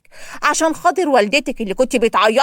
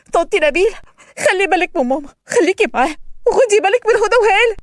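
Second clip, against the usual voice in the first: higher, urgent parting voice; tearful plea; 8 semitones higher, 4 dB louder than usual. A narrator's reading. Daughter begs neighbour Nabila to care for her mother, urgent. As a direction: beg the neighbour to watch over mum.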